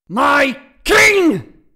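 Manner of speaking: huge high roar of outrage; full-force enraged shout; thundering. A narrator's reading. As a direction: bellow it word by word. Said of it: "voice thundered off the high ceilings".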